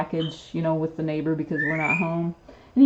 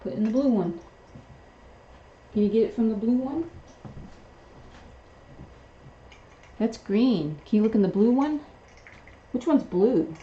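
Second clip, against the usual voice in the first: higher, playful pet-talk to a parrot; talking to pet bird; 4 semitones higher, 3 dB quieter than usual. Real, not acted. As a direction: owner teasing her parrot for dropping food. Asking parrot for the blue one.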